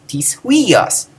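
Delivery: tis kept light, huios swooping high then falling; tis left unstressed. Shown as a demonstration. Teacher proving tis goes unemphasized, stress on huios. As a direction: put the weight on huios, not tis. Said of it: "No, I didn't".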